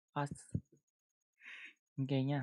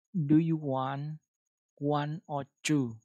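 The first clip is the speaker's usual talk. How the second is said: slow, loud dictation; 9 dB louder, much slower than usual. Shown as a demonstration. Teacher reading slowly and loudly to the app for students. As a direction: Do you want one or two, each word drawn out slowly and loudly. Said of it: "chúng ta quay trở lại ứng dụng và chúng ta đọc nó".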